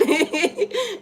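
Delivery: high-pitched burst of laughter; amused, high laugh. Real, not acted. Laughs recalling her wet face.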